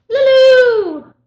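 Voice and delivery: high loud call across room. Real, not acted. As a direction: call the dog from afar. Calling dog across room.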